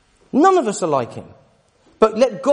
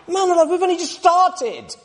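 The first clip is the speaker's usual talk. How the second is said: amazed exclamation; 12 semitones higher than usual. Real, not acted. Amazed aside while reading Genesis.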